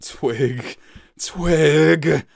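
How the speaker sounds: father crying out to his son. Acted. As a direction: call the son's name, pained. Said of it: "Cloud Wolf cried".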